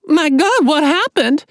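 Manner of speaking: high, loud and frightened; alarmed girl, voice climbing; panicked high voice. Acted. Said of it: "voice went a note or two higher".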